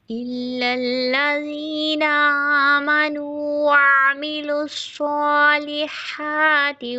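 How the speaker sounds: slow, drawn-out melodic tilawat; Quran recitation. Acted. Teacher reciting Surah Asr for students, slow chanted recitation. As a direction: recite each word long and measured.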